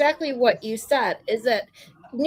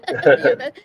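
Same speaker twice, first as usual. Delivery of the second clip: usual speaking voice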